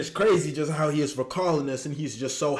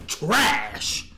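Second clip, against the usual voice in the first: laughing scorn; 10 semitones higher than usual. Real, not acted. Mocking verdict while laughing.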